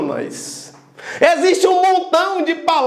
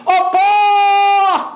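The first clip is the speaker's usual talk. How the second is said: higher, drawn-out call on "pó"; calling out the syllable; 6 semitones higher, 4 dB louder than usual. Shown as a demonstration. Teacher demonstrating the stressed syllable to students, calling out. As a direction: shout it long, holding the stressed vowel. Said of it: "Agora grite ela".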